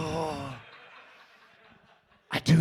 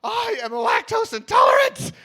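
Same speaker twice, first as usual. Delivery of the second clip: very high shrieking protest; hammed-up panic squeal; panicked shriek; 12 semitones higher, 7 dB louder than usual. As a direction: shriek to get her off you. Panicked pushing girl away.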